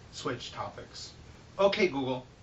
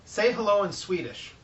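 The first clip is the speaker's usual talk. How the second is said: higher, louder command to a speaker; talking to voice assistant; 5 semitones higher, 5 dB louder than usual. Real, not acted. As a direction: speak up clearly for the device.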